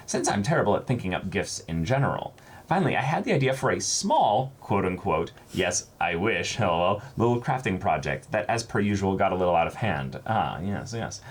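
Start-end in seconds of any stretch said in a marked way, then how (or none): 0.0-10.2 reads a fan letter aloud a bit higher; bright read-aloud voice for the viewer's letter; reading a viewer's kind note to the camera. Acted.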